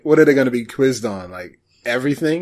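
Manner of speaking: ordinary delivery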